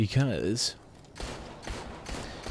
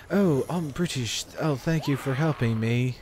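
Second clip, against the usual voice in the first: higher, mock British game acting; emotionless British accent; 5 semitones higher than usual. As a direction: imitate a stilted British voice actor. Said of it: "try to put some emotion in your voice".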